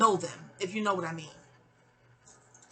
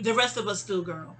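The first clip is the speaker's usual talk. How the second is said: ordinary talk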